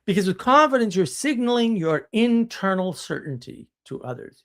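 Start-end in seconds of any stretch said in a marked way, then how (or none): none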